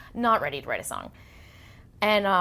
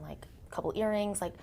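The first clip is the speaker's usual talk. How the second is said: retold whisper; 3 semitones higher, 7 dB quieter than usual. Nanny whispering about a stranger.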